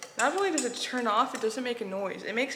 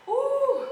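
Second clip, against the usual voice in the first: loud, high-pitched "ooh"; high startled "ooh"; 7 dB louder than usual. Real, not acted. Vlogger cooking alone, a high, loud "ooh". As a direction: let out a sudden high whoop.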